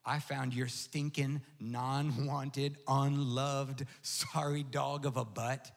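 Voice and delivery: voice of the Lord. Acted. The Lord speaking to pastor John through the dog, low and tender. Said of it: "he goes".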